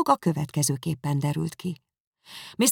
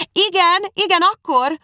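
much higher, louder exclamation; cry out; 8 dB louder than usual. A narrator's reading. Mother crying out about the ball date, much higher and louder. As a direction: blurt it out in sudden alarm. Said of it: "kiáltott Missus Bennet".

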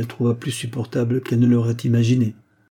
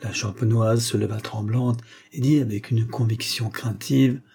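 usual speaking voice

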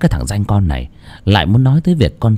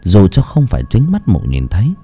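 ordinary delivery

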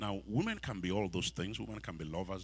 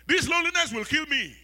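high, loud, desperate cry; lonely single crying out loud; loud lament; 13 dB louder than usual. Acted. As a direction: plead in anguish at loneliness. Voicing lonely single people.

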